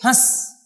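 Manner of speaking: हंस as a short "hãs", no separate n; quick, short vowel. Shown as a demonstration. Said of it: "जल्दी से बोलेंगे".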